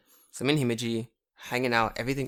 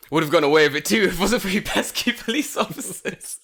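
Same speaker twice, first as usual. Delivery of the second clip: higher, louder hammed-up grumble; foiled villain; 5 semitones higher, 5 dB louder than usual. Culprit to child police officers, hammed-up foiled villain. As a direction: play a cartoon villain caught out. Caught-villain parody line.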